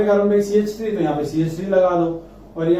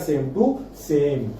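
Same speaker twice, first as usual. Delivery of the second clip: drawn out; 2 semitones lower, much slower than usual. Shown as a demonstration. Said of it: "बाकी ये कैसा रहेगा".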